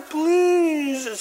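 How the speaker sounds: high, drawn-out mock plea; melodramatic begging. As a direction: plead like a servant slumping to his knees. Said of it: "says the servant as he slumps to his knees".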